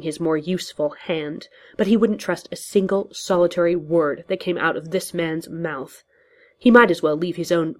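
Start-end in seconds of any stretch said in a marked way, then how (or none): none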